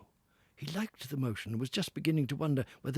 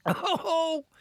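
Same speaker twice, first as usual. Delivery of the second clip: loud, much higher cry of delight; loud ecstatic cry; 9 dB louder than usual. Acted. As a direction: burst out in self-delight. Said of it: "he cried in ecstasies of self-admiration".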